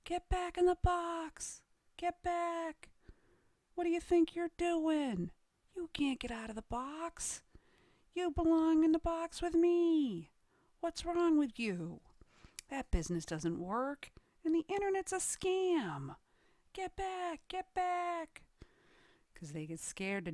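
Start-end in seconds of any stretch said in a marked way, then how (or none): 0.0-12.0 mock yelling. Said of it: "start yelling at you". 12.7-18.4 high-pitched scolding warning; mock yelling. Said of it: "start yelling at you".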